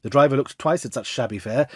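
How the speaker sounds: ordinary delivery, nothing marked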